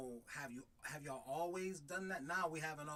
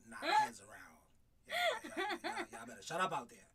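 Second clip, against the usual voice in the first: mock yell; 11 semitones higher than usual. Husband replaying his yell at the noisy kids, laughing. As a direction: holler it at the kids outside, then crack up. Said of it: "That day when you yelled at me?".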